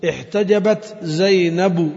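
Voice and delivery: slow, deliberate "احتجبت زينب" with full ending; slow recitation demo. Shown as a demonstration. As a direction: read it out slowly like a recitation model. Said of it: "في الوقف". (قراءة بطيئة متأنية لـ "احتجبت زينب")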